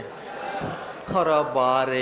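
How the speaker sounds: drawn-out, sing-song fake wailing; mock wail. Teacher pretends to cry to his class, sung mock wail. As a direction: ham up a pretend cry, very slowly. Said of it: "थोड़ा मैं रो लूँ क्या बैठ के".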